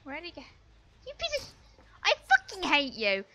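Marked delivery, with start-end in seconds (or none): 1.1-1.5 high, quick squeal of frustration; high-pitched cursing. Real, not acted. Gamer cursing a player who got away, high-pitched. 2.0-3.2 loud, exasperated gamer rage; angry outburst. Real, not acted. Gamer raging at opponent Quentin, angry outburst.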